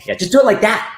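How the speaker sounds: high-pitched director impression; Zack Snyder. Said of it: "That's a good Zack Snyder impression".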